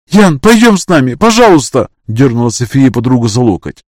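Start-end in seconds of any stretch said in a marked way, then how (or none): 0.1-1.9 loud, high begging cry; desperate pleading. Acted. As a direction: beg her loudly to come. Friend begging her to flee.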